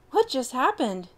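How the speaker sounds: groggy after the crash; dazed surprise. Acted.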